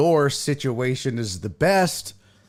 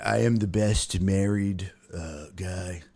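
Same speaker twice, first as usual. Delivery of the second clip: lightly voiced Trump boast, softer; a hint of Trump bragging; mock Trump brag, understated; 7 semitones lower, 4 dB quieter than usual. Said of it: "I do my Trump voice".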